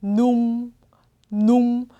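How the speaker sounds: numb said like "noong", lips sealed at the end; lips closed at end. Shown as a demonstration. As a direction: model numb as "noong" closing into a hum. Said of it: "이렇게 입을 마지막으로 다물어 주셔야 된다".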